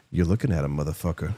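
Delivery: quietly menacing, low and heavy; Tony Soprano line. Retold or imitated. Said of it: "Tony Soprano looks down and he goes".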